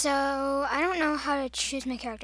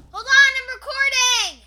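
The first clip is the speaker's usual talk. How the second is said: yelling much higher and louder; shouting; 12 semitones higher, 11 dB louder than usual. Real, not acted. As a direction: call out to someone in another room. Shouting back to mom.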